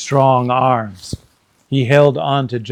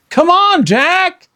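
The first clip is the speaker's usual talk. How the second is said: put-on high, loud girl's shout; 4 dB louder, slower than usual. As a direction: urge him on loudly. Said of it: "Annie shouted".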